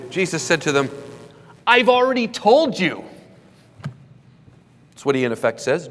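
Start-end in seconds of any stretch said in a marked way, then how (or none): 1.6-3.0 high, loud exasperated retort; frustrated. Acted. Jesus answering the question yet again, audibly frustrated. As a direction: raise the voice in frustrated insistence. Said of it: "I detect a hint of frustration".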